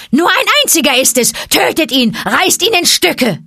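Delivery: high, loud, fast screeching cry; shrill monkey shout. A narrator's reading. Monkeys scream at the panther, loud and high. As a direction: screech for blood like a monkey mob.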